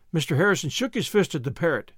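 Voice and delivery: plain reading voice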